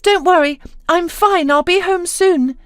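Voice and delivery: bubbling with relief; excited reassurance. A narrator's reading. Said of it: "she added excitedly".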